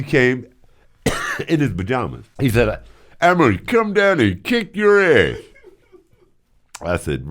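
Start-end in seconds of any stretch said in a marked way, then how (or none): 3.2-5.4 high, louder mock angry threat. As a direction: bark it like the furious boss. Retelling angry boss's threat.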